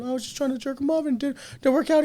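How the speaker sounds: high, fast, hammed-up sheepish voice; light cartoonish excuse voice; mock sheepish Joe. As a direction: squeak it out like a guilty kid. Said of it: "He goes".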